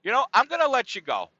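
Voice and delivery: high, loud mock rant; hammed-up angry mother voice; imitating angry mom. Son imitating his upset mom hanging up, high and loud. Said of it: "She said".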